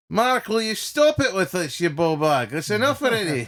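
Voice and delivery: exasperated telling-off. Retold or imitated. Driver scolding Mark for winding him up, exasperated telling-off. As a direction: put on a fed-up driver giving him a row.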